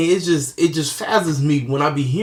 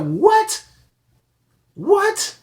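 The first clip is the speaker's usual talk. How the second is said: much higher, incredulous rising "What?"; stunned disbelief. Retold or imitated. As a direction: react as if you can't believe your ears. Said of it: "I'm like".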